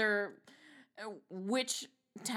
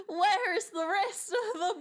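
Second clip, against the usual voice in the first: laughing; 6 semitones higher than usual. Acted. Laughing through British cop line.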